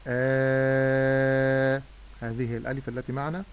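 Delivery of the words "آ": آ held long from the throat, louder; drawn long vowel. Shown as a demonstration. Sheikh showing the alif of madd, long and full. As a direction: sustain a long open آ. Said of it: "فأقول آ".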